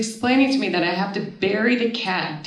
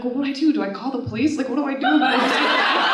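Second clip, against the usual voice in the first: higher-pitched, helpless questioning; mild mock panic; 6 semitones higher, faster than usual. Woman asking her sister for help, mildly panicked. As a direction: play up being at a total loss.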